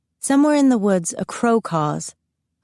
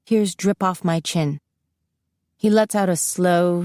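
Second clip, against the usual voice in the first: usual speaking voice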